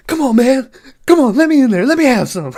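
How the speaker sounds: loud, high-pitched pleading in imitation; mock eager begging. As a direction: plead loudly as the worked-up character. Acting out Bluto begging at car window.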